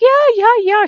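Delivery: high, loud, eager imitation; excited. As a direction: mimic someone bursting with excitement. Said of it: "She's all excited".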